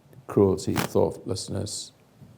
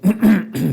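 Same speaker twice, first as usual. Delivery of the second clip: rough throat clear; 9 semitones higher, 7 dB louder than usual. Real, not acted. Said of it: "because of my voice".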